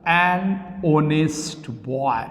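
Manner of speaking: put-on an honest boy drawn out very slowly word by word; patient teacher modelling the phrase slowly; slow demonstration. Shown as a demonstration. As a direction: spell it out slowly for the class. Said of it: "तब हमको लगाना पड़ता है".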